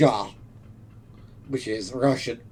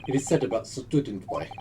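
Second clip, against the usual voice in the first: put-on Russian accent; 4 semitones lower than usual. Plays Russian sailor.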